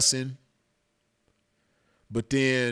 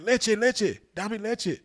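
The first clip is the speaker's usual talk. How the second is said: retold scream; 10 semitones higher, slower than usual. Maced man begging strangers for milk, retold scream. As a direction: imitate a frantic plea in Spanish. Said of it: "I was screaming".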